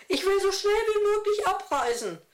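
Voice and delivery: hohe, quengelige Frauenstimme; maulend. A narrator's reading. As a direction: beleidigt vor sich hin maulen. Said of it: "maulte eine andere". (hoch und trotzig gemault)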